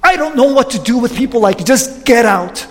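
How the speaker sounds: guard giving up and releasing the pastor; frustrated dismissal. Retold or imitated. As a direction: throw up your hands and send him away. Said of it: "So out of frustration, he says".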